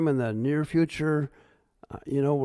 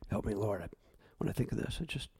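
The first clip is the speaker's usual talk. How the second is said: choked up; 7 semitones lower, 11 dB quieter, much faster than usual. Real, not acted. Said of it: "I'm coming to tears".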